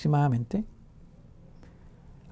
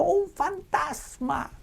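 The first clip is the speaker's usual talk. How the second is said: high, drawn-out frightened cry of the disciples; terrified cry; 12 semitones higher, much slower than usual. Acted. Disciples in the storm crying out in fear. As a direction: stretch it out in high-pitched terror. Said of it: "se turbaron diciendo".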